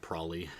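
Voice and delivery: "probably" cut down to PRAH-lee; old-time Utah pronunciation. Shown as a demonstration. Streamer recalling the older version, "probably" as PRAH-lee. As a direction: leave the b's out of "probably". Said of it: "it was something along the lines of".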